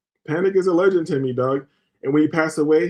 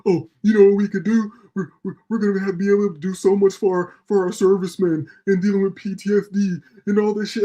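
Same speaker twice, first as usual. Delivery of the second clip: mock contractor voice; 4 semitones higher than usual. Mocking military contractors at dinner.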